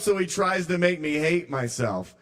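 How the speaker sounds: ordinary talk, nothing marked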